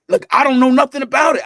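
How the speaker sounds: dad admitting he had no clue, flustered and loud; flustered outburst. Retold or imitated. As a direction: blurt it out, totally lost. Dad panicked about first period.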